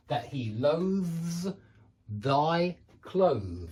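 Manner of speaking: "loathes thy clothes" slow with voiced th sounds; teacher repeats the tongue-twister slowly; careful demo. Shown as a demonstration. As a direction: lean into each voiced th, slowly. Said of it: "you've got to do it twice".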